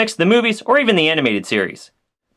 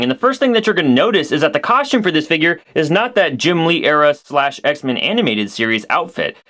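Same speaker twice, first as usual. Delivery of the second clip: usual speaking voice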